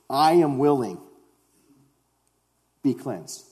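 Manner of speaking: firm, deliberate declaration with a pause; authoritative. Retold or imitated. Jesus answering a man with leprosy, authoritative. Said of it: "The authority in which Jesus speaks".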